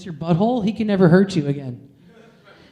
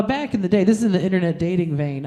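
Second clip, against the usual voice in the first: ordinary delivery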